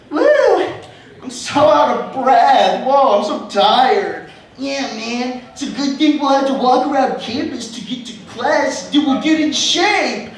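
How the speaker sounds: hammed-up out-of-breath friends; mock winded friends. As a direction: parody friends gasping after a short walk. Friends mocked, panting on campus.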